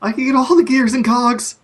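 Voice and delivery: high excited gnome voice; eager gnome. Acted. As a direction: dream aloud of gadgets. Gnome pleading in character.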